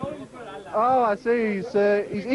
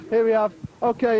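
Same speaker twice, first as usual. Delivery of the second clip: usual speaking voice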